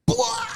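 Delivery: very high, loud wrestling war cry; mock ring yell; wrestler's roar. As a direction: roar like you just hit a Stone Cold Stunner. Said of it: "He's like".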